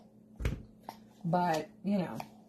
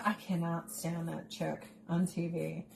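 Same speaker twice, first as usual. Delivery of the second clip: usual speaking voice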